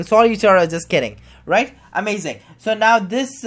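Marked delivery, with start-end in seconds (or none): 0.0-1.2 brighter and louder, playful; happy. Shown as a demonstration. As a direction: say it cheerfully, joking. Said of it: "So I'll make a happy sigh".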